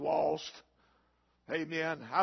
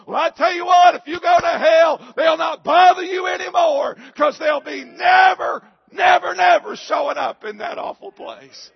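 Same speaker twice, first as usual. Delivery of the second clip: much higher, much louder shouting climax; shouted preaching; 12 semitones higher, 16 dB louder than usual. Real, not acted. Pastor warning the congregation, shouting at full force. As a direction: hammer it home at full voice.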